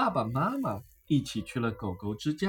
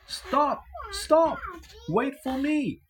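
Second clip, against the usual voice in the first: higher, calling out after a runaway dog; shouting after the dog; 11 semitones higher, slower than usual. A narrator's reading. Amber calling after the dog running off, shouting. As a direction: yell after the dog to stop. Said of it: "shouted Amber".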